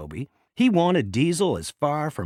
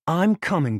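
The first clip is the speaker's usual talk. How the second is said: louder, higher call across the line; 6 semitones higher, 7 dB louder than usual. A narrator's reading. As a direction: call out to reassure Thomas. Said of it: "Diesel called".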